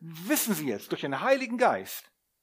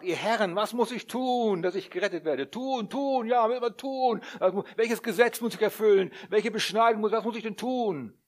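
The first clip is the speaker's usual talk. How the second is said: jailer pleading; 6 semitones higher, 5 dB louder than usual. Acted. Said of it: "der Kerkermeister ... sprach".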